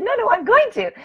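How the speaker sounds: high, playful insistence; excited insistence. Retold or imitated. Telling the store lady she will win, high playful insistence. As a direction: gleefully correct her. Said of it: "I said".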